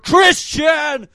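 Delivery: high, loud shout; shouted name. Acted. Host shouting out his homegirl Tristan, loud. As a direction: call the name out big.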